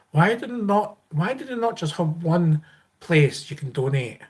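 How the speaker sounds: ordinary talk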